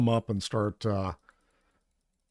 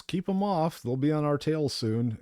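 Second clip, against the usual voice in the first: higher, lightly hammed quest-giver line; mock NPC urgency; 6 semitones higher than usual. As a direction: read it like an urgent game character, half joking. Reading quest NPC line aloud.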